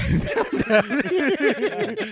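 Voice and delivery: high, loud burst of laughter; helpless laughter. Real, not acted. Friend cracking up at the bath story, high laughter. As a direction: crack up completely.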